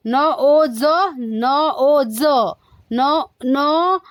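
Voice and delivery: न ओ ज as three separate drawn-out sounds, ज as z. Shown as a demonstration. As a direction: sound out न, ओ, ज one by one. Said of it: "N के लिए न रीड किया". (न ओ ज को अलग-अलग ध्वनियों में बोलें)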